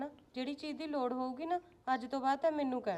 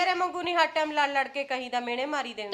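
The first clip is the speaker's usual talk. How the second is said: loud, heated quarrelling; angry quarrel; 8 dB louder than usual. Acted. Mother-in-law arguing with the daughter-in-law, loud and angry. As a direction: throw the words back at her.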